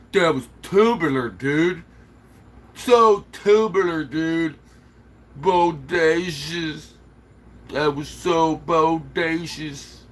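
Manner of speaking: lightly voiced surfer dude, slow and stretched; mock hippie surfer. Showing viewers old hippie slang, hint of surfer dude. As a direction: drawl it out like an old-school surfer. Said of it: "rad is more like hippie".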